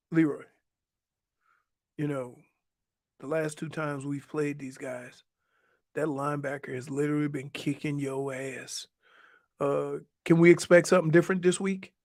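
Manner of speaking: low, deadpan lightly done Belichick; dry, quiet coach jab; low mumbled Belichick ribbing a player; Belichick impression. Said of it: "That's Bill Belichick".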